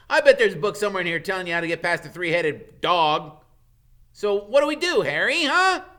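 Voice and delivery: itching for adventure; thrilled plotting friend; excited Ron. Acted. Ron's voice excited.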